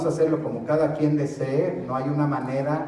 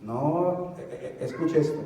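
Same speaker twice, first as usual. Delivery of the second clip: ordinary talk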